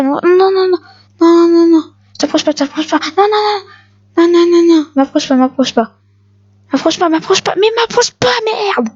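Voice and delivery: high frantic string of refusals; hammed-up panicked yelping, pitched high; mock panic. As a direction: squeal it out while running away. Fleeing an enemy in panic.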